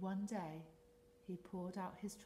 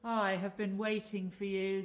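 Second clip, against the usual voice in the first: deep octopus voice; 11 dB louder than usual. Acted. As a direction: speak deep like an old octopus. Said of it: "with a deep voice".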